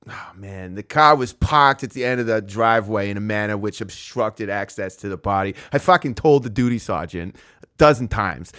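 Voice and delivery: put-on Boston police officer voice; Boston cop accent. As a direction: rehearse a cop's line with broad Boston vowels. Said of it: "like, a Boston cop accent".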